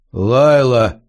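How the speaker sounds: long, drawn-out calling of a name; Elm drawing it out. A narrator's reading. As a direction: stretch the name out, falling at the end. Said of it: "протянул Эльм".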